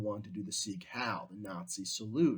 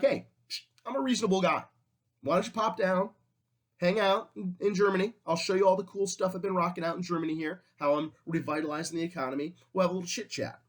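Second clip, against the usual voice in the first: higher, lightly voiced Hitler playing the reasonable guy; a hint of a falsely chummy dictator, pitched up; mock-friendly Hitler; 6 semitones higher, much faster than usual. Hitler invites the other countries to Germany, mock-friendly and higher. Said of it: "And Hitler's like".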